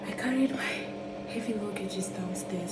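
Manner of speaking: winded and panting after the stairs; out of breath. Real, not acted. Just carried heavy luggage downstairs, out of breath. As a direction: catch your breath between words. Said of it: "I'm breathing so fast".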